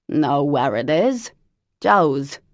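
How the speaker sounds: loud, put-on voice of an old chain smoker; Lavinia's horrible voice. Cousin Lavinia phoning Parker, loud put-on smoker's voice. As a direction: bark it out like a lifelong smoker.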